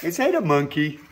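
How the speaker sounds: usual speaking voice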